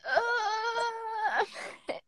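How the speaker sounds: slow, choked whispered sob; breaking down into a tearful whisper; real tears, barely voiced; crying whisper. Real, not acted. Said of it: "I'm trying not to".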